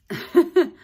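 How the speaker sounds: high, loud laugh; bright laugh. Acted. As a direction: burst out laughing. Laugh heard.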